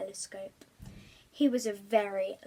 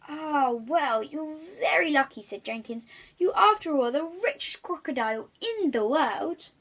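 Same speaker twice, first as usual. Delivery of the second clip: higher, louder butler voice; 4 semitones higher, 5 dB louder than usual. Acted. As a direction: speak as the patient butler.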